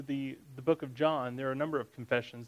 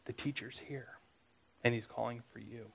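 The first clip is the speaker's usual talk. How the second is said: much softer, hushed secret message; secretly; 2 semitones lower, 9 dB quieter than usual. A narrator's reading. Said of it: "saying secretly".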